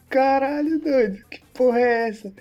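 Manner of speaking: put-on high, loud shocked yell at the kid; yelling in disbelief; shocked shout. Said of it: "Aí eu falei".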